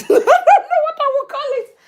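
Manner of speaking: speaking through a high laugh; giggling through the line. Real, not acted. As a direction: laugh the words out. Laughing at her own story.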